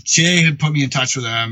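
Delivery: normal talking voice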